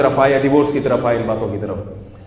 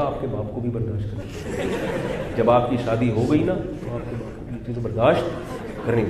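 low, hushed comeback under the breath; retold cautious aside, much softer; very quiet retort; 4 semitones lower, 9 dB quieter than usual. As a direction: say it softly so he barely hears. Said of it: "بہت آہستہ سے بولا".

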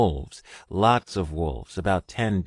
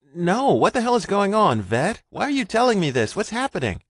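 much higher, loud, frightened; alarmed, demanding answers; panicked questions; 12 semitones higher, 6 dB louder than usual. Acted. Richard panics at sudden orders.

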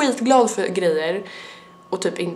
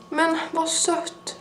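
tender aww; 8 semitones higher, 7 dB quieter, much slower than usual. Real, not acted. Sees the necklace.

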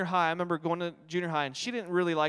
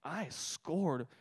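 slow, softer self-satisfied gloat; smug husband; 3 semitones lower, 9 dB quieter, much slower than usual. Retold or imitated. Husband pleased with himself after shopping, slow soft gloat. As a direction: savor it like he just won. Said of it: "He's like".

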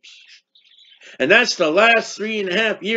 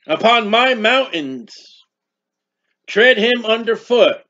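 slow, solemn preacher's reading; measured pulpit reading of scripture; 2 semitones higher than usual. Acted. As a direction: read the verse out slowly and weightily. Reads scripture aloud.

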